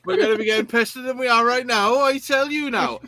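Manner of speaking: put-on tipsy Welsh accent. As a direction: slip into a Welsh accent by accident. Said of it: "that went Welsh".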